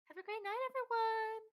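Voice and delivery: high bright farewell call; cheerful sign-off. Real, not acted. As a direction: sing out goodbye to chat. Streamer sign-off.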